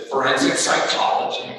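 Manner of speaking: loud, ready reply imitating the daughter; quick retold answer. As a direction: answer at once and firmly, as her. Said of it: "she was very quick, she said".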